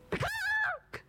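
very high squawking chicken cry; shrill bird call. Acted. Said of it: "said Chicken".

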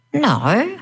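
high, drawn-out moan of no. A narrator's reading. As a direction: groan the no in dismay. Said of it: "our friends moaned in unison".

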